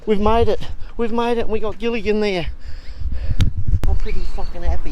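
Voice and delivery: high, loud cheer of relief; jubilant arrival. Real, not acted. Skipper landing his boat at trip's end, elated. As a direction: shout out the joy of finally making it. Boat lands after long trip.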